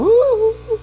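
very high, drawn-out ooh of wonder; healed woman on the phone, very high ooh; high delighted ooh. Retold or imitated. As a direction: sing out a long high ooh. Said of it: "And I heard her go".

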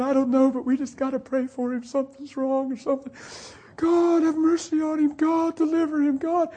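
high, choked-up pleading; imitated tearful prayer. Husband praying in the car for a missing friend, imitated crying voice. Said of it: "He's praying and he's crying".

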